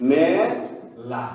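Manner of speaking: মেলা stretched very slowly, each sound clear; slow model pronunciation. Shown as a demonstration. Teacher demonstrates the right মেলা to students, slow and careful. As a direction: draw out মেলা slowly for the class. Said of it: "মেলা উচ্চারণ হবে মেলা".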